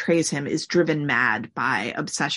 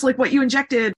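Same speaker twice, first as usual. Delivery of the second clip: mock pleading interviewer; 9 semitones higher, 5 dB louder than usual.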